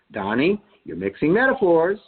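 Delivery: a hint of a correcting English teacher; a touch of the teacher. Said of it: "my teacher would say".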